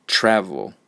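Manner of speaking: loud first syllable of "Travel", relaxed falling second; strong-weak syllables. Shown as a demonstration. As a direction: put the effort into the start of "Travel" and none into the end. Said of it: "for the second syllable, I'm not trying very hard".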